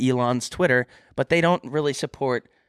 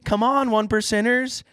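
hype-man call; 11 semitones higher than usual. Pretend. Hyping up the one percenters.

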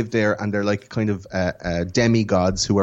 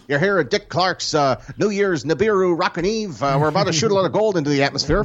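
higher, put-on American TV host; New Year's host impression; 6 semitones higher than usual. Hosting a Nibiru New Year's Eve broadcast, put-on showman accent. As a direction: welcome viewers to the big countdown show. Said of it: "listen to you do accents".

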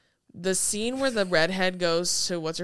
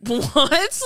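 loud, high, baffled "what"; raised-pitch stunned question; incredulous reaction; 7 semitones higher, 7 dB louder than usual. Retold or imitated. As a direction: blurt it in mock disbelief. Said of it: "And it's like".

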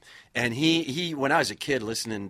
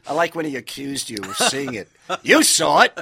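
loud, high, rhythmic broadcaster voice; Cosell-style announcer; 5 semitones higher, 13 dB louder than usual. Retold or imitated. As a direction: punch each phrase like a classic TV announcer. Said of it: "Howard Cosell".

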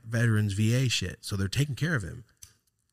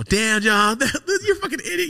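much higher, fast and laughing through the words; laughing tease; 7 dB louder than usual. Retold or imitated. Teasing his senile uncle by text, laughing hard. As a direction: crack up while calling him an idiot.

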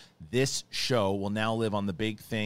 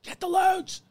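very high, loud frantic command; frantic order; 5 dB louder, slower than usual. Retold or imitated. Boss on the boat, very high frantic order. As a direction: scream the order over the waves. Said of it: "He's like".

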